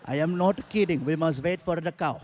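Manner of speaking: put-on insistent Indian accent; Indian-accented driver firmly refusing to budge. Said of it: "I asked the driver".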